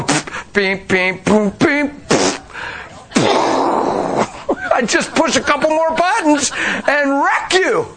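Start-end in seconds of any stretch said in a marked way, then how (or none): 0.0-4.7 button sound effects. Retold or imitated. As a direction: mouth beeps and puffs. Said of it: "if I was the devil ... I'd go".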